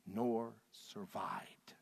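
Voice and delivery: hushed slow whisper. Real, not acted. Preacher telling the congregation no soldier lived, slow whisper. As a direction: let it die into a breath. Sermon climax, drowning army.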